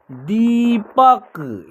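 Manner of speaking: Deepak drawn out slowly, syllable by syllable; slow careful reading. Shown as a demonstration. Teacher first reads Deepak slowly for students. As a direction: say Deepak slowly so learners can follow. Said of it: "इसका उच्चारण होगा".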